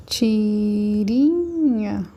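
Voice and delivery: "Tirinha" very slow, with "ri" stretched and swelling; drawn-out stressed syllable. Shown as a demonstration. Teacher sounding out an example to find strongest syllable, drawn-out "ri" in "Tirinha". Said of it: "Qual que é a sílaba mais forte?".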